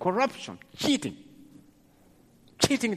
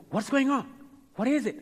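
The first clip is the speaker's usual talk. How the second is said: high, eager questioning; acted out curiosity, pitched up; urgent asking; 8 semitones higher than usual. Blind man asking about the crowd's noise, high urgent questioning.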